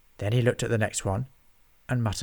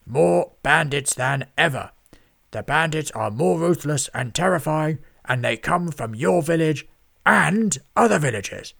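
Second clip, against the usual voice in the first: reading aloud gleefully; 5 semitones higher, 6 dB louder than usual. A narrator's reading. Lord Lloyd reads a bandit headline aloud to himself, booming. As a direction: relish the scary headline. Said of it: "Lord Lloyd read aloud".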